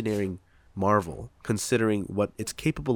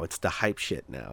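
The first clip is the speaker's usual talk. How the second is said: ordinary talk, nothing marked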